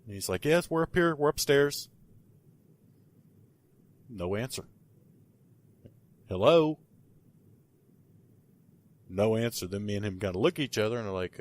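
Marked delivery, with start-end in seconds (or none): 0.4-1.9 mock yell. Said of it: "we yell out for her". 6.3-6.8 a louder, higher hello called up into the house; calling out. Retold or imitated. Calling down the stairs to the lady, a mild mock call.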